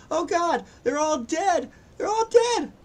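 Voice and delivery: high, loud cry of terror; desperate screamed report; panicked yelling. Acted. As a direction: yell it in frantic, high-pitched horror. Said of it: "She's yelling".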